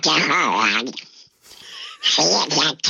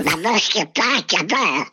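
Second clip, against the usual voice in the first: squawky, spluttering duck voice; Kalle Anka. Shown as a demonstration. Guest answers as the character when host asks, in duck voice. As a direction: quack each phrase like a cartoon duck. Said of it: "då hittar du exakt hans".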